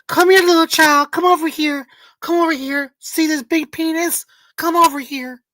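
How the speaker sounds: high, loud, luring mock child-bait voice; creepy puppet voice. Streamer mocking the sock puppet luring kids, high creepy voice.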